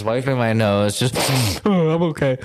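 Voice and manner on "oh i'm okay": fake composure. Hiding tears from the cop.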